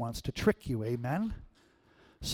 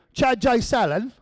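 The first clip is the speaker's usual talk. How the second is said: high, loud put-on Australian accent; mock Australian accent; 8 semitones higher, 7 dB louder than usual. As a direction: call out a name in hammed-up Australian. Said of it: "that was English or Australian".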